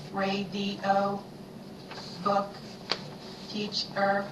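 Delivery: even, chopped robot syllables; robot speak. Shown as a demonstration. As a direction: chop each word into pieces like a robot. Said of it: "say these words like the robot".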